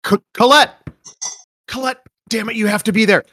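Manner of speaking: urgent voice through a wall; muffled through a door. Acted. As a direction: shout muffled behind a door. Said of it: "a muffled voice saying".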